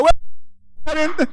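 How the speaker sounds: high-pitched Puerto Rican holler; gleeful high cheer; celebratory whoop. Acted. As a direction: whoop it while dancing away. Puerto Rican whoop while dancing away.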